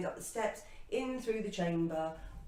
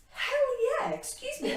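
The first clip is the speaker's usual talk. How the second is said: very high, loud triumphant cry; retold victorious exclamation; triumphant whoop; 6 dB louder than usual. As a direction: burst out in defiant delight. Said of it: "all of a sudden it was like".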